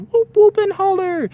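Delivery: loud, very high whoops; whooping holler. Shown as a demonstration. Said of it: "You just need to make noises".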